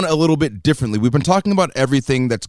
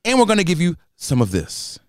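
ordinary talk, nothing marked

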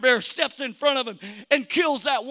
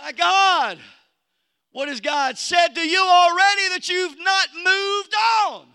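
loud, high, slow shouted preaching; preaching at full force; 7 semitones higher, 7 dB louder than usual. Acted. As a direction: drive the call to move home to the church. Preaching climax continues.

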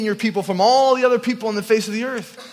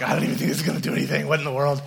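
skeptical inner thought; 5 semitones lower, 4 dB quieter, much faster than usual. Retold or imitated. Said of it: "In my head, I'm like".

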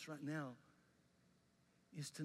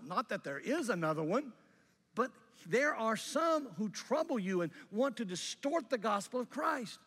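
higher, louder, fierce preaching; holy rant; 5 semitones higher, 8 dB louder than usual. Retold or imitated. Pastor reading Paul's letter to Galatia, fierce loud rant. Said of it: "what I'm about to read to you is a holy rant".

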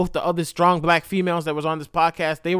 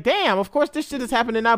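ordinary delivery

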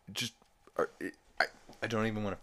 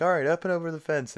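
urgent mom; 11 semitones higher, 6 dB louder, much faster than usual. Retold or imitated. Lois urging the family.

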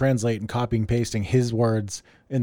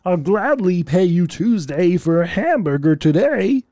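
slow, higher, exaggerated cartoon voice; Wimpy; 5 semitones higher, 4 dB louder than usual. Co-host recalling the Popeye character, hammed-up Wimpy voice. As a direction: draw it out in a broad cartoon impression. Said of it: "Do you remember Wimpy?".

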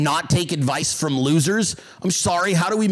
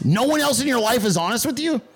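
ordinary talk

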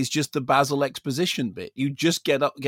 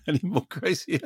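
laughing mock repeat; 2 semitones higher than usual. Co-host mocks the phrase.

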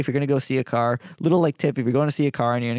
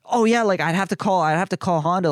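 mock eager tech, pitched higher; imitating the tech; 7 semitones higher than usual. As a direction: play the helpful tech a little high. Tech voiced in car story.

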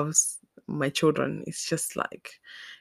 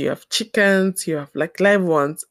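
usual speaking voice

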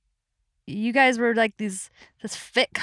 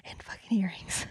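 whispered punchline of dashed hopes; deflated mock whisper; 2 semitones lower, 10 dB quieter than usual. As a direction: drop to a whispered letdown. Punchline of the acted thoughts.

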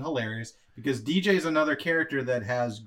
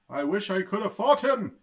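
grandiose villain voice; 8 semitones higher, 7 dB louder than usual. Retold or imitated.

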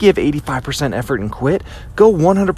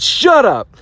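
sharp, high shout at the inner voice; firm shout; 9 semitones higher than usual. Retold or imitated. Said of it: "I stood up and I said".

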